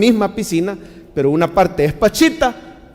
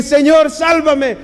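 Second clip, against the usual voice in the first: higher, louder, slow cry for rescue; urgent plea; 9 semitones higher, 4 dB louder, slower than usual. Shown as a demonstration. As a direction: call out slowly for saving. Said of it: "Le puede decir". (súplica alta y lenta)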